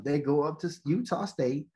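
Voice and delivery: usual speaking voice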